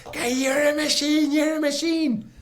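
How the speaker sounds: very high, loud mock cheer; hammed-up crowd praising him; rescuers cheering. The crew praising the rescued swimmer, mock high cheer.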